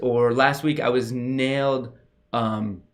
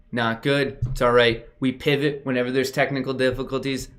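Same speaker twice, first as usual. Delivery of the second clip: ordinary talk, nothing marked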